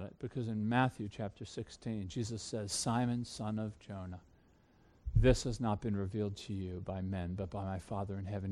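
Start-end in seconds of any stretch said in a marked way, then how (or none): 2.7-8.5 hushed tender quote. Retold or imitated. Quoting Jesus to Peter.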